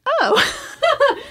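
high, loud "oh" breaking into laughter; a big mock realization, drawn out; retold surprise. Wife retelling her sudden change of thinking, laughing surprise.

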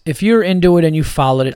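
usual speaking voice

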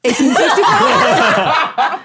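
put-on slow, drawn-out numbers in a laughing German accent; German accent, mocking the numbers. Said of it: "You say your number so wrong in this language".

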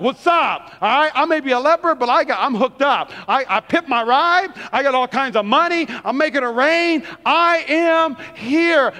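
hammed-up boastful swagger; swaggering show-off. Naaman rolling up with all his money, swaggering. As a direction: brag like a big shot making an entrance. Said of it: "like, rolled up".

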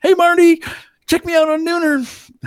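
high, loud excited shout; excited bystander. Pretend. Imagining being in a viral video, shouting high and loud. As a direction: yell it like a thrilled extra in a viral clip. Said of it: "in the background going".

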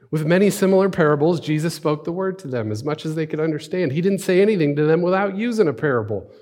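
high, loud, energetic narration; emphatic scripture reading. Acted. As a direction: proclaim the passage with lifted voice. Second, livelier reading of passage.

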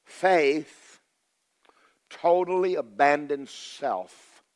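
loud, slow, punching Faith; emphatic slow declaration. Real, not acted. Preacher driving home his key statement, loud and slow. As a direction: hammer each word out, leaning hard on Faith. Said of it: "never forget what I'm fixing to say".

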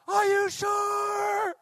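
high, long drawn-out holler; shouting over wind. Acted. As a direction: sailor yelling back through the gale. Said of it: "You have to shout".

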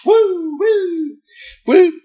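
high, loud whooping woo; acted suspect whooping as the cops close in; mock wild whoop. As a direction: whoop it up like a giddy joyrider. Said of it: "She's like".

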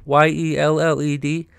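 "Y E L L E D" spelled letter by letter. Shown as a demonstration. Vlogger spells the word for viewers, letter by letter. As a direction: spell it out plainly, each letter apart. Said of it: "I don't want it to sound like I'm saying held down".

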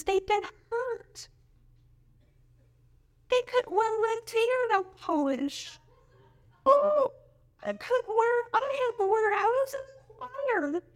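high, whiny mocking voice of a complainer; mock whiny victim. Pastor mocking a member who feels hurt by rules. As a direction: whine it like a wounded church member.

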